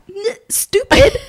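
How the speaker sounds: high, slow mock scolding of herself; exasperated at herself; self-scolding. Real, not acted. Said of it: "scolding herself".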